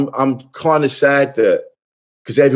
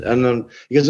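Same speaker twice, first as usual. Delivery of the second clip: ordinary talk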